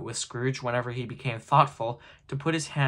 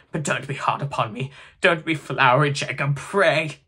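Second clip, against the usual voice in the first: anxious pleading; 3 semitones higher, 7 dB louder than usual. A narrator's reading. Scrooge begs the ghost for mercy.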